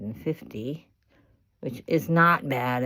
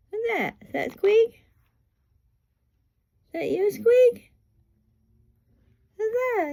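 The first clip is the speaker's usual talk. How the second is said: coaxing pet talk; 6 dB louder than usual. Real, not acted. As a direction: quiz a pet bird in a very high voice. Talking to the parrot.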